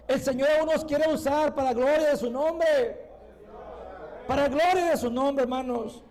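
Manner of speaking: full-voiced, driving church exhortation; rally the congregation with fervor; impassioned preaching. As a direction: preach it out, high and forceful.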